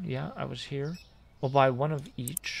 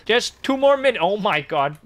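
higher, louder, rushed in panic; panicked yelp; 6 semitones higher, 6 dB louder than usual. Real, not acted. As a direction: cry out as the queue piles up. Customers piling up at checkout.

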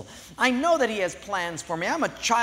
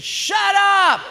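high, loud shout; angry yell; 13 semitones higher, 9 dB louder than usual. Acted. Atheist neighbor yelling at the singing lady. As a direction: yell across at her. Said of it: "He says".

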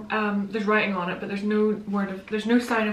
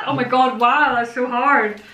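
usual speaking voice

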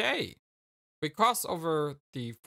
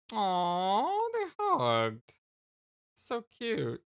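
aww; 7 semitones higher, much slower than usual. Real, not acted. Reacting to a hug.

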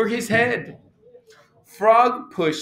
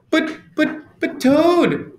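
high, stammered protest; crying out; 9 semitones higher than usual. A narrator's reading. Frog objecting as Toad returns to bed, crying out. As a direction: protest high, building to the name. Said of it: "cried Frog".

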